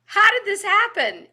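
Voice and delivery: astonished inner voice; shocked thought. Retold or imitated. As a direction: blurt it in disbelief.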